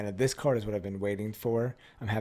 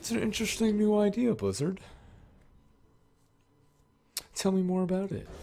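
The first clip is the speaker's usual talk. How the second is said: high-pitched, playful put-on voice; mock-intrigued voice; 10 semitones higher, 4 dB louder than usual. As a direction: coax the absent company in a high mock voice. Acted line addressed to absent Blizzard.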